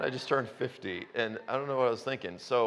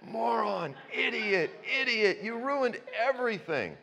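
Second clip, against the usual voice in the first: Adam scolding himself; 10 semitones higher than usual. Retold or imitated. Imagined Adam scolding himself for ruining everything, lightly voiced. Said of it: "he's going".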